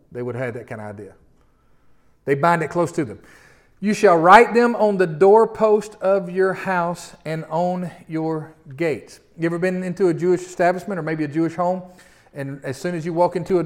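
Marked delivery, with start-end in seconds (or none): 3.8-9.2 slow, measured reading of the passage; solemn scripture reading. Acted. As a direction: read the verses slowly, as holy words. Reading the next verse.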